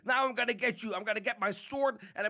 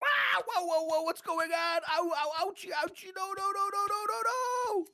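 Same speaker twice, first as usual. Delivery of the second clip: high panicked ow and no; rising frantic cries of pain; panicked yelps; 6 semitones higher than usual. Real, not acted. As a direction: yelp as you're caught and hurt. Player being attacked by web.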